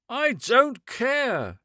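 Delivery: high, slow, loud near-shriek; almost shriek it at her. A narrator's reading. Man shrieking back at the woman, high and slow. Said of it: "he almost shrieked".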